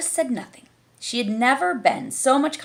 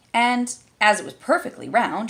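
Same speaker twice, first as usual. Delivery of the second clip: ordinary delivery, nothing marked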